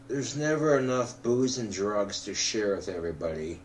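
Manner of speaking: ordinary delivery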